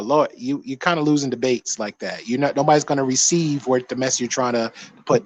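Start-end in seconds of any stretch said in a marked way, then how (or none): none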